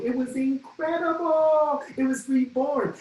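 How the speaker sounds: thrilled, high exclamation; excited. Real, not acted. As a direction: marvel at how London was reborn. Awed about new London.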